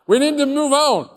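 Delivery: high, loud shout, repeating the demand; forceful shout. Retold or imitated. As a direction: slam the point home at full voice.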